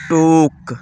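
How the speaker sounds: ٹوک with a held OO, as "took"; long OO sound. Shown as a demonstration. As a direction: stretch the OO in ٹوک. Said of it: "او کی آواز دیتا ہے". (ٹوک میں پیش والی لمبی آواز)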